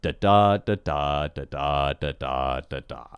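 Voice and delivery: "da, da" in pairs, second of each pair stressed; iambic beat. Shown as a demonstration. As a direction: chant "da, da" as an even weak-STRONG beat, sinking in pitch. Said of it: "it goes like this".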